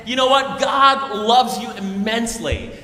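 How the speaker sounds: loud, slow, insistent shout; match his yelling, but with love; preacher yelling back at a drunk. Retold or imitated. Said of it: "I met him in his language".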